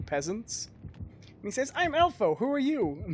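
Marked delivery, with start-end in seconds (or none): 1.7-3.0 chirpy Elfo voice. Retold or imitated. Voicing Elfo meeting peasants.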